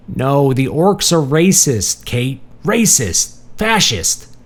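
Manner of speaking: high, emphatic, stretched-out outburst; agitated insistence. A narrator's reading. Marty correcting his visitor about orcs, agitated. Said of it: "He made his hands into fists".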